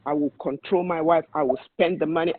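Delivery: ordinary talk, nothing marked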